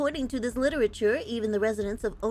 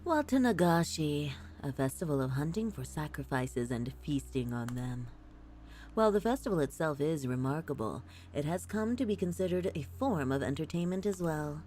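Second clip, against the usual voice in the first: low, measured narrator voice; solemn document reading; 5 semitones lower, 4 dB quieter than usual. Acted. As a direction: read it like a grave written record. Reads game document aloud.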